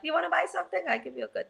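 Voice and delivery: put-on high, pushy Italian-accented sales voice; Italian seller pitch. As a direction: sell it like a street hawker.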